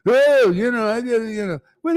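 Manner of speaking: lightly ape a disciple's grumbling; mock complaint. Teacher imitating disciple turning against him, loud and high.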